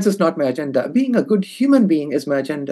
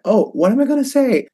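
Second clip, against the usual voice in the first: mildly shocked, higher-pitched reaction; retold shock; 6 semitones higher than usual. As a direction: voice a taken-aback inner reaction. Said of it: "I was so shocked".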